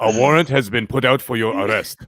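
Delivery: low, flat scam caller; robocall voice. Retold or imitated. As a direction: read it like a recorded threat.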